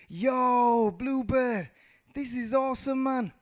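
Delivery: high, loud excited shout; thrilled. Acted. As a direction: call out, thrilled to be flying. Mouse thrilled, calling while flying.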